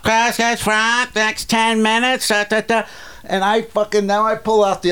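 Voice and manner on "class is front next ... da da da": mock announcement. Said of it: "the announcement's going off".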